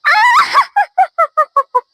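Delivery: high, wicked cackle of triumph; satisfied cackle. Acted. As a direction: laugh out high and smug like a villain. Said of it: "Nyi Endit tertawa puas". (tawa jahat yang puas dan tinggi)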